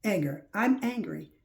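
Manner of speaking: ordinary talk, nothing marked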